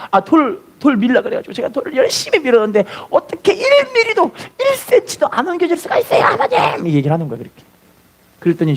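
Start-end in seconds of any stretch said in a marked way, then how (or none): 0.1-6.8 high, indignant outcry to God; crying out. Retold or imitated. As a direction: plead up high in frustration. Boy cries out to God.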